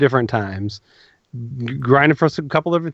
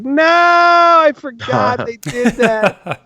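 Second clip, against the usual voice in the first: dismayed self quote; 11 semitones higher, 4 dB louder, much slower than usual. Retold or imitated. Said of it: "I'm like".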